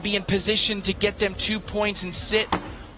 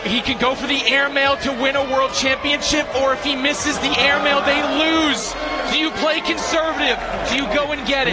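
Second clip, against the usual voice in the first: fast, higher tense build-up; tense excitement; 7 semitones higher, faster than usual. Real, not acted. Commentator weighing the winning throw, tense excitement. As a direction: race through the stakes. Final bag, title on line.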